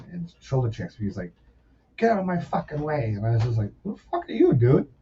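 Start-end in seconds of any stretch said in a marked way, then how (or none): 2.0-3.1 higher-pitched mock demand. Singer shoulder-checking someone in line, mock-hostile impression. 3.8-4.9 high-pitched indignant retort; mock indignation. Teenager challenging the man who shoved him, mock indignant.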